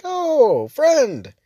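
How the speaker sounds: friendly greeting. Retold or imitated. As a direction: gush a warm hello at a stranger. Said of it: "They were like".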